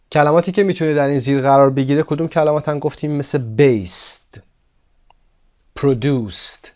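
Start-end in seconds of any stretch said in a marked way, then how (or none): 3.1-4.4 based ending in a crisp t; final t sound. Shown as a demonstration. Teacher models unvoiced ending in based. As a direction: say based with a voiceless t end. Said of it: "اینها صدای س داشتن در انتها".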